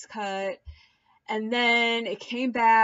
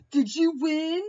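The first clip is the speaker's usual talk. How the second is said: higher, rising mock taunt; taunting question; 7 semitones higher, 4 dB louder than usual. Mark taunting Josh about the win, higher rising mock taunt. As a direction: needle him with the question. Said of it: "And then Mark was like".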